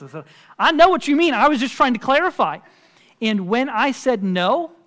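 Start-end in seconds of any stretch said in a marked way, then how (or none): 0.6-2.6 imitated defensive protest, higher and louder; hasty backpedalling. Retelling his reply to the offended caller, hasty backpedalling. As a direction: blurt a quick, loud clarification.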